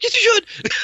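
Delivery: very high-pitched excited urging; acted frantic insistence. Deer expert answering the clueless hunter, frantic excitement. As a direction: squeal it as a hyped-up imitation.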